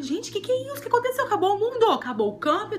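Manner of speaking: high startled panic; sudden high-pitched alarm; panicking as the lights and shouts arrive; alarmed surprise. Retold or imitated. Panicked reaction to the search.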